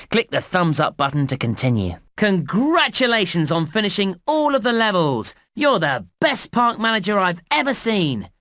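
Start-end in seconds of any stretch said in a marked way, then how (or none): 2.2-8.3 high-pitched, celebratory praise; jubilant congratulations. Acted. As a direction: cheer the player's big win with raised pitch. Congratulates player on finishing all levels.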